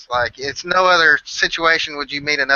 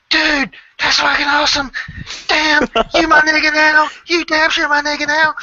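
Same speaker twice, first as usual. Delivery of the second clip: very high, loud delight; thrilled; 14 semitones higher, 4 dB louder than usual. Retold or imitated. Ed welcoming a new flat-earth friend, very high and excited. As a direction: celebrate finding a fellow believer.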